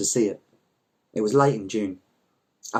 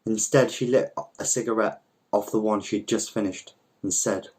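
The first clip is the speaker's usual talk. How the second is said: plain reading voice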